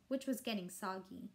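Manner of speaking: plain reading voice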